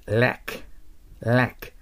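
ラック with a strong vowel between a and e; crisp ラック with the æ sound; English "lack". Shown as a demonstration. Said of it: "あとえの中間の音を強く出してください".

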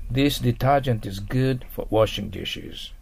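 slow model reading. Shown as a demonstration. Teacher reads model sentence slowly.

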